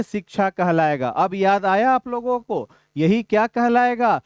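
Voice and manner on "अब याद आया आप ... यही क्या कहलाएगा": normal talking voice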